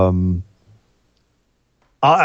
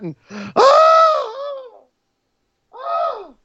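overwhelmed cry; much slower than usual. Real, not acted. Overwhelmed at the end of retelling.

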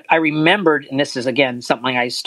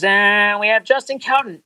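higher, drawn-out guest introduction; imitated announcer; 6 semitones higher than usual. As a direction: announce the surprise guest slowly, pitched up. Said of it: "And he says".

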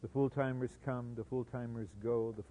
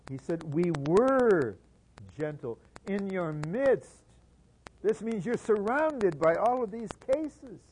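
slow, higher and louder, pressing each word; preacher driving the point home to the church; fervent preaching; 7 semitones higher, 8 dB louder, slower than usual. Acted. Preacher urging the congregation, intense preaching.

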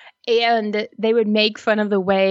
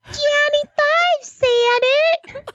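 put-on much higher, slow laughing kid squeal; mock kid voice; much slower than usual. Friend echoes the kid line, high and slow. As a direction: stretch it out high while laughing.

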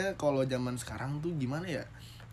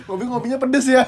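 laughing; 7 semitones higher, 10 dB louder than usual. Real, not acted. Joking about coffee with sambal.

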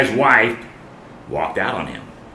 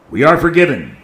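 higher and louder we are forgiven; proclaimed; 5 semitones higher, 5 dB louder, faster than usual. Shown as a demonstration. Preacher to congregation, rising proclaimed repeat. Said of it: "repeated for emphasis".

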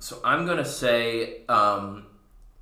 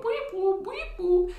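very high weep whoop siren noise; imitated siren; slower than usual. GM voicing the laser horses' sirens, high siren imitation. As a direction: sing out a cartoon police siren. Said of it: "sirens going".

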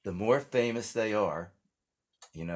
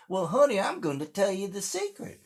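put-on old country grandma; 11 semitones higher than usual. Grandma advising the new bride, high sweet granny voice. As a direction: play a ninety-year-old Southern grandma. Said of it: "She goes".

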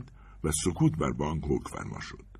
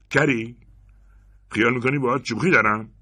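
higher, louder menacing question; gun-to-belly threat; 7 semitones higher, 6 dB louder than usual. Acted. Robber jamming the pistol into Anders' belly, loud and menacing. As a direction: push it at him, furious.

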